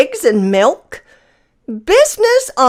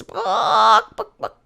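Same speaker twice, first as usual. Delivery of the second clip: hen clucking; 4 dB quieter, slower than usual. A narrator's reading. Hens clucking on the farm.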